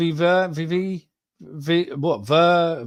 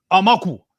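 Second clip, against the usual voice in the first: mock African accent; 4 semitones higher, 7 dB louder than usual. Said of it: "putting on an African accent".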